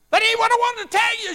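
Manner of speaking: high, loud insistent correction; excited. Retold or imitated. Widow cutting off the preacher, excitedly insisting. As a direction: burst out, eager to get to the point. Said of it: "she was just excited".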